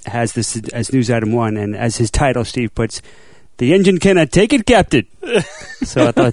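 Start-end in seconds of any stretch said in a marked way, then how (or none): 3.6-5.1 high, loud mock Scottish engineer; hammed-up Scottish alarm to the captain; Scotty accent. Reading Steve's title as the engineer, in a mock Scottish accent. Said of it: "give us a Scotty accent".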